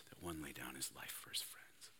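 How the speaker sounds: soft unvoiced whisper; whispered scripture. Real, not acted. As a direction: breathe the words out. Quoting Jesus, fading to whisper.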